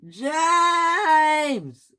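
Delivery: high, drawn-out shout. A narrator's reading. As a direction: bellow the name in one long high note. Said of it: "shouted a voice".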